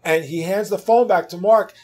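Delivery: ordinary talk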